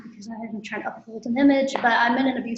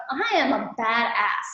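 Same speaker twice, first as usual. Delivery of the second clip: ordinary delivery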